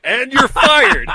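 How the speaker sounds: shouting through loud laughter; cracking up while yelling it; laughing. Real, not acted.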